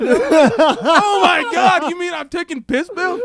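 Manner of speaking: high, loud, slow laughing disbelief; horrified disbelief. Retold or imitated. Realizing he took piss pills, high and laughing. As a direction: gasp it in mock horror.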